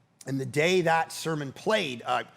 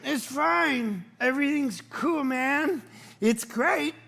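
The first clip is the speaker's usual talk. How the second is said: high, strained show of being fine; forced calm; 6 semitones higher, slower than usual. Retold or imitated. Dad reassuring daughter after the spill, forced calm. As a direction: reassure through held-back irritation, comically. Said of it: "so I was like Jesus".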